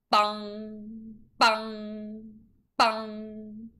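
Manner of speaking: long, even ringing bongs; bell bong. Acted. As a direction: ring it out like a big bell. The park bell rings.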